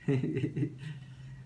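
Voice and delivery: quiet amused chuckle; a soft laugh at himself. Real, not acted. Laughs at own imagined scene.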